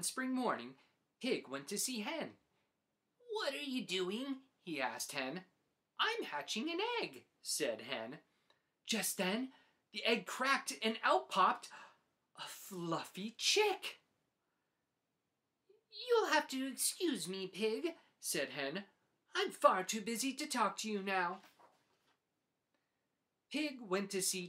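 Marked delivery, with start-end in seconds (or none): 3.3-4.4 higher, eager little pig voice; curious pig asking; Pig's voice. Acted. Pig asks Hen what she's doing, eager and curious. 6.0-7.2 much higher, busy hen voice; a fussy mother hen; Hen's voice. Acted. Hen tells Pig about her egg, high and fussy. 15.9-21.4 higher, brisk hen brushing Pig off; too busy to chat. Acted. Hen dismisses Pig, brisk and busy.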